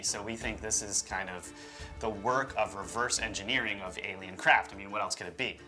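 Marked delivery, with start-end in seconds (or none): none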